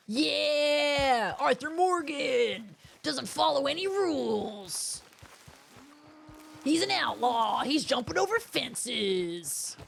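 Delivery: slow announcer-style hype; hammed-up hype. Jumping the fence, narrating Arthur in mock hype. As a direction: proclaim it like a cheesy trailer.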